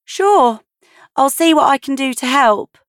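bright offer of help; Cynthia's voice. A narrator's reading. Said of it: "Cynthia told her".

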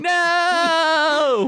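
high, drawn-out wail of protest; hammed-up doomed scream; mock anguished cry. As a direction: cry out as if banished. Mock anguish at being sentenced.

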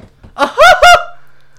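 high, loud cackling laugh; laugh impression. Shown as a demonstration. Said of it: "this is my next impression of the next guy".

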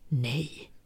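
cold whispered verdict of the authority; ridiculing the insurance agency's verdict in a whisper; mock official verdict. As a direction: whisper the agency's ruling with contempt. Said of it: "beslut blir ändå alltid detsamma".

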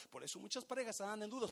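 ordinary talk, nothing marked

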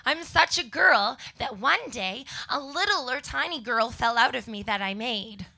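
normal talking voice

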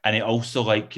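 ordinary talk

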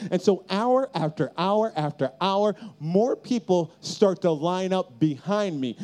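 normal talking voice

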